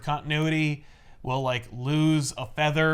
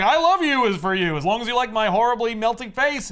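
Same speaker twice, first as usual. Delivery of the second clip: louder, higher romantic declaration; hammed-up lover voice; 8 semitones higher, 5 dB louder than usual. Preston declares love to Lady Clay, higher and louder. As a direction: overplay the love line.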